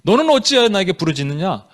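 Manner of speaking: firm, reproachful question; rebuking question. Shown as a demonstration. God questioning Moses for crying out, stern rebuke. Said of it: "책망이에요?".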